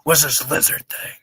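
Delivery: low gruff soldier whisper; Snake voice. Retold or imitated. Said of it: "Snake's codec calls".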